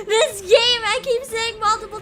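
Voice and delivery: high, loud, laughing through words; hysterical laughing. Real, not acted. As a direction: laugh hysterically while talking. Hysterical over gore.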